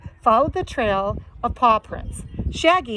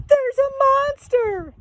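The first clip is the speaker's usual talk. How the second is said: frightened shout. Acted. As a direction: scream it like you've seen a ghost. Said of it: "Miss Pinchface shouted".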